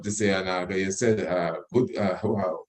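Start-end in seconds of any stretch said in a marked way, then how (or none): none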